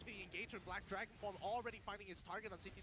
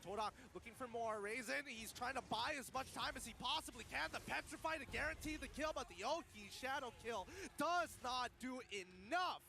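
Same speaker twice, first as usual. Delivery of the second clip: esports caster; 5 semitones higher, 4 dB louder than usual. Real, not acted. Hyped play-by-play of a fight.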